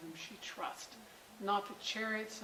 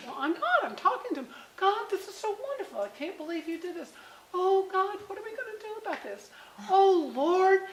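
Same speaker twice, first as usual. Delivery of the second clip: higher, louder, with laughter; excited talking to God; 10 semitones higher, 9 dB louder than usual. Retold or imitated. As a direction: gush out loud to God in delight. Mom retelling her talk with God.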